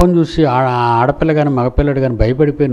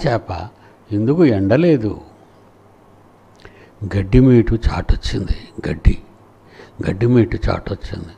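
schoolbook story recitation; 4 semitones lower than usual. Retold or imitated. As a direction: tell it like the old school reader, measured and low. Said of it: "నేను చదువుకున్నానండి పాఠం". (బడి పాఠం కథ చెప్పే స్వరం)

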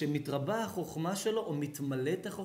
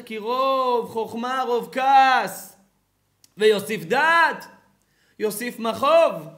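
very loud, high and slow; booming verse; 6 semitones higher, 13 dB louder, slower than usual. Acted. Rabbi thundering Kohelet's verse at his listeners, slow.

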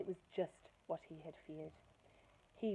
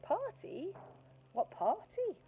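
higher mock-innocent question; feigned blankness; 13 semitones higher, 4 dB louder, slower than usual. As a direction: pretend not to know her at all. Bertie feigning ignorance.

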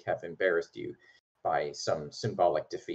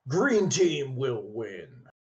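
slow, loud, higher bellow of a burly knight; 5 semitones higher, 5 dB louder, much slower than usual. Acted. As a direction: roar it out slowly like a battle cry. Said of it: "he sort of bellows out".

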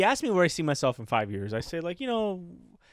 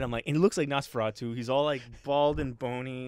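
normal talking voice

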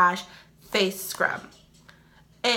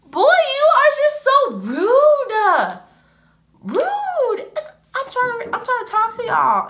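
much higher and louder, slow exasperated scolding; scolding son; 7 dB louder, slower than usual. Real, not acted. Scolds rude son mid-video.

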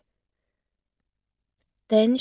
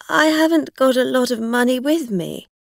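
loud, raised plea; anxious protest; 6 semitones higher, 8 dB louder than usual. A narrator's reading. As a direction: plead that you can't pay. Driver protests demand for money.

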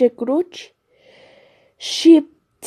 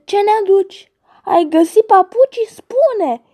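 eager demand; 7 semitones higher than usual. Acted. Geese surround and demand.

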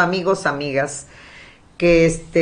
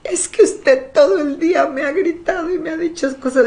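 high, laughing imitation of a whiny complaint; mock tearful coworker; 10 semitones higher than usual. Imitating the crying coworker's complaint, high and laughing. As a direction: mimic the teary girl, half laughing. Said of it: "coworker's tearful reply retold".

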